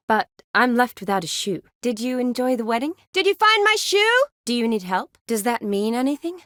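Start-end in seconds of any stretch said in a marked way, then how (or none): 3.1-4.2 high, loud called question; calling out. Acted. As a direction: call it out high and urgently. Calling out about lost shoe.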